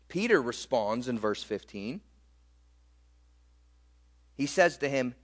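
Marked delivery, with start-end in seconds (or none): none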